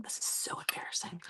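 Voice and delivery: breathy hushed confession; embarrassed whisper. Real, not acted. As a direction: whisper it as if ashamed. Embarrassed aside mid-story.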